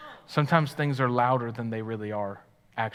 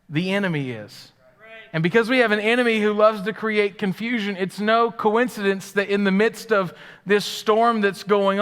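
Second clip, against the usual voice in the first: louder, higher preaching build; full-force preaching; 7 semitones higher, 7 dB louder than usual. Acted. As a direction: drive the point home from the pulpit.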